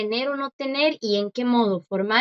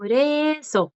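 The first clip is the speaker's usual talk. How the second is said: "Por eso" drawn out, higher pitched; higher, stretched-out model of "Por eso"; slow pronunciation demo; 4 semitones higher, much slower than usual. Shown as a demonstration. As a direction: prompt the learner with long vowels in "Por eso". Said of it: "¿cómo es?".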